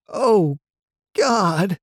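high, anguished moan. A narrator's reading. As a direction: moan it in despair. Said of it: "the man moaned".

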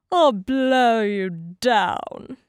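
loud, very slow wolf threat; threatening wolf. A narrator's reading. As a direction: stretch the threat out loudly.

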